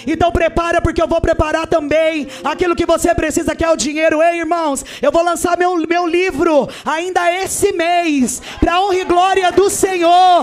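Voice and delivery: loud, high preaching climax; shouting the sermon with fervor; fiery preaching. Real, not acted. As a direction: preach it at full force to the church. Preaching at full force.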